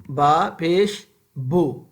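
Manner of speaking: "با پیش بو" spelled out slowly, each syllable separate; slow drill syllables. Shown as a demonstration. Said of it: "جب بھی ہم پڑھیں گے".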